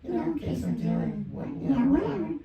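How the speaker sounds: high, little pitch-up effect. Shown as a demonstration. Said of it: "let's do pitch up".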